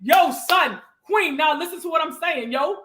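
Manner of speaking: hammed-up Brooklyn accent impression; Brooklyn Mike. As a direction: hail them like a Brooklyn big brother. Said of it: "Brooklyn Mike is my big brother".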